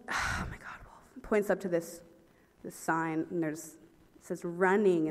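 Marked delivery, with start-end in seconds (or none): 0.1-1.2 whispered, soft and fed up; exasperated whisper. Retold or imitated. Coach giving up on her, soft exasperated whisper. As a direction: breathe it out in defeat. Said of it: "He's like, sighs".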